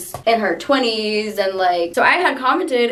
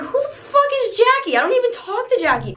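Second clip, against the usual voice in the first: pitched way up, fast, outraged disbelief; indignant outburst; 14 semitones higher than usual. Retold or imitated. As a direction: fire it back at the principal like it's absurd. Retold confrontation in principal's office.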